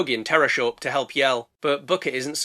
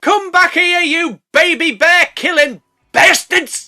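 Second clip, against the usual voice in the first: loud, high slow mock-furious yell; furious bear roar-shout; 12 semitones higher, 9 dB louder, slower than usual. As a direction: chase them down as the angry mother bear. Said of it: "mama grizzly like".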